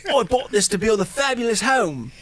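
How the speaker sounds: a hammed-up German accent; terrible German accent. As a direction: play the old German host showing off. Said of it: "It's my terrible German accent".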